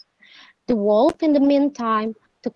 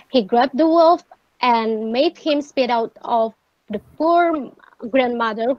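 ordinary delivery, nothing marked

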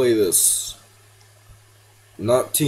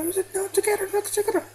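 panicked squeal; faster than usual. Real, not acted.

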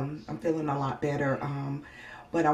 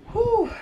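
pained whistle exhale; 7 dB louder than usual. Real, not acted. Pain exhale.

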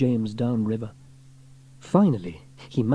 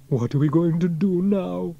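higher, worried groan; pitched-up despairing character voice; groaned dismay; 6 semitones higher than usual. A narrator's reading. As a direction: groan the question helplessly. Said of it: "groaned Elvis".

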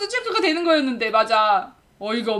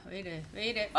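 normal talking voice

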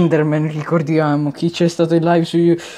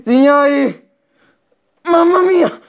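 excited; 8 semitones higher, 6 dB louder than usual. Real, not acted. Reacting to game moment loudly.

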